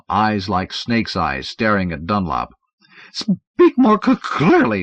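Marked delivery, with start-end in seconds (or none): 3.1-4.8 high, slow impatient stammer. Acted. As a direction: demand it impatiently. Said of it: "Dunlop requested impatiently".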